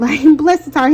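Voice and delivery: amused, giggling repeat; laughing. Real, not acted.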